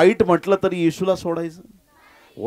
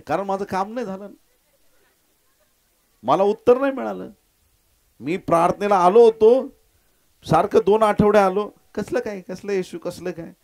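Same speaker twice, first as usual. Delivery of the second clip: aggrieved complaint; 6 semitones higher than usual. Acted. Voices a disappointed churchgoer complaining.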